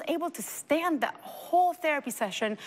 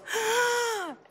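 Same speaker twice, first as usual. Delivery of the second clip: sharp high gasp of delight; a child's sudden joyful gasp, pitched way up; imitated gasp; 13 semitones higher than usual. Mother imitating her daughter's happy gasp on seeing her. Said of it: "she goes".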